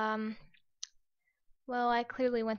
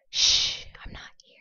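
whisper. Pretend. Hiding from players.

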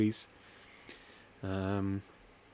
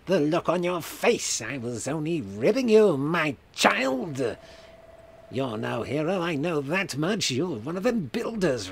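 Skeletor voice. Acted. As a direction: sneer it like a cartoon villain. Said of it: "I should do a Skeletor voice".

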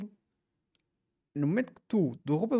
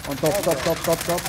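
loud repeated warning shout; urgent callout; 6 dB louder than usual. Real, not acted. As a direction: bark the enemy position. Urgent callout during firefight.

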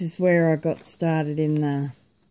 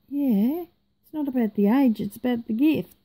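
cheeky retort; 7 semitones higher than usual. Retold or imitated. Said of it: "I know, naughty".

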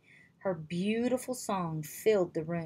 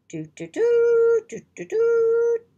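high loud trumpet blasts, toot toot; 11 dB louder, slower than usual. A narrator's reading. Narrator imitates morning trumpets, high loud trumpet toots. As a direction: blare it like brass trumpets. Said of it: "Trumpet players stood on the king's balcony".

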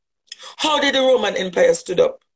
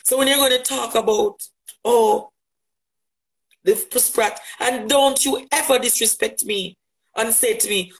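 ordinary delivery

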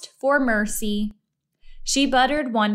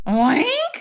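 higher, questioning oink rising at the end; pig oink; 6 semitones higher, 4 dB louder than usual. A narrator's reading. Mercy the pig waking in the hole, rising oink. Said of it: "said Mercy".

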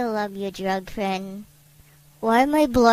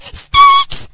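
scream name; 12 dB louder than usual. Real, not acted. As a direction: shriek the name at full volume, very high. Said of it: "I don't want to scream your name".

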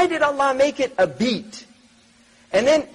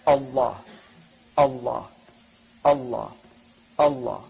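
heartbeat chant; 13 semitones lower, 4 dB quieter than usual. Shown as a demonstration. Imitating what the heart says: deep rhythmic chant. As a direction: pulse each "Allah" deep and even like a beating heart. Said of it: "It says".

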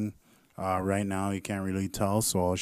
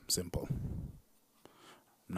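quiet aside; 10 dB quieter, much slower than usual. Real, not acted.

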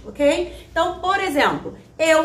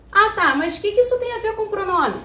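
higher, quicker voice of a questioning student; aluno confuso; 7 semitones higher, faster than usual. Acted. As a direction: play the student asking what the point is. Encena a dúvida do aluno. (aluno questionando, em tom mais agudo e rápido)

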